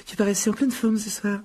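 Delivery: put-on high-pitched girl justifying herself; Hermione sur la défensive. A narrator's reading. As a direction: pitch it up, innocently explaining. Hermione se justifie devant Mimi. (voix aiguë qui se justifie)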